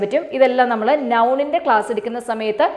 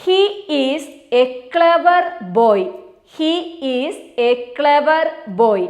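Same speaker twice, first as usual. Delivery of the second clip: slow example dictation; much slower than usual. Shown as a demonstration. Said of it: "For example".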